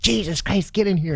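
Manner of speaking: high, urgent mom's order; mother hauling her son inside; exasperated mom. Retold or imitated. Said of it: "She goes".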